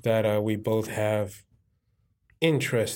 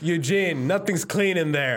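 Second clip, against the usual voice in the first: exclaiming; 7 semitones higher, slower than usual. Acted. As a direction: call it out amused. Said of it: "I'm like".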